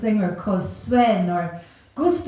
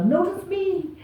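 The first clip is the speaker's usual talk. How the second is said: needy actors; 8 semitones higher, slower than usual. Retold or imitated. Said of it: "they were all like".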